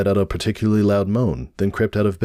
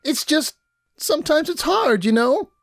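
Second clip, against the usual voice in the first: put-on much higher girlish voice, sorrowful; sad Pinkie Pie. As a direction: pour out hurt feelings. Pinkie confessing sadness.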